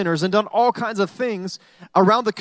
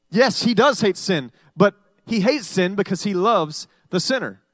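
normal talking voice

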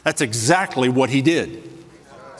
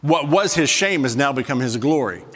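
ordinary talk

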